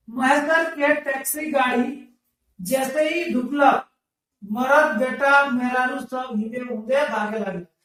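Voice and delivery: slow sing-song text reading; lilting recital of the text; reading aloud. Acted. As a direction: chant the passage like a class reader. Reads the dialect text aloud.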